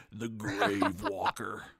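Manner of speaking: slow, drawn-out boss-name announcement; boss name reveal. Pretend. Co-host imagining a Diablo boss's name, slow grand announcement. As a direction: draw the name out like a game boss intro.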